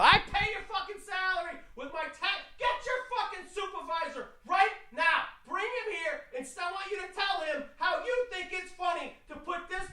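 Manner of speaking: high, strained furious rant at the TSA agent; furious tirade. Retold or imitated. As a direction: let it rip, voice cracking high with outrage. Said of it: "I let off a fucking tirade".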